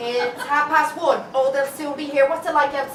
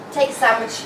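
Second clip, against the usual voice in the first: ordinary talk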